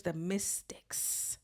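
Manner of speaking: breathy hushed whisper of the mystics. Real, not acted. Proudly whispering about her friend's panel. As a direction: let it fall to a whisper. Emotional listing, drops to hush.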